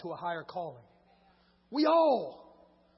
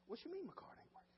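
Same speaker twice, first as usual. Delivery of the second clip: higher, soft and indignant; mock indignant reply; 8 semitones higher, 18 dB quieter than usual. Pastor replaying his irritated answer to the cashier. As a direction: snap back in disbelief, quietly. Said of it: "I'm getting angry, mad".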